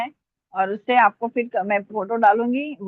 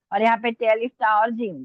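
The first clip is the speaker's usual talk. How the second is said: normal talking voice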